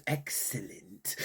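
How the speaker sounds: low, hushed, slow villainous purr; a soft, scheming "excellent" like a cartoon tycoon; Mister Burns. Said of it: "like Mister Burns".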